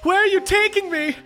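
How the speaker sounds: very high, wailing prisoner voice; shrill Sebastian. Acted. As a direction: squeal it like a pampered captive. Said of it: "you hear Sebastian go".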